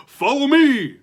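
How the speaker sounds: loud high eager call; excited cry. Acted. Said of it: "cried Loop as he excitedly".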